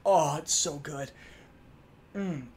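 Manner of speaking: ordinary talk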